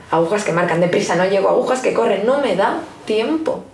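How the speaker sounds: fast, pressing, rising at the end; hurried panic of no time; rushed, breathless. Acted. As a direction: race the clock. Clock hands racing, no time.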